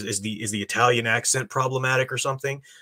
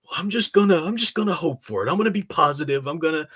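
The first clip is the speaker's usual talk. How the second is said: higher-pitched voice of a hopeful moviegoer; mock naive optimist; 6 semitones higher than usual. Warning viewers against blindly hoping for the movie, mock-optimist voice. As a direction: imitate silly optimism, pitched up. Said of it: "you'd be silly just to say".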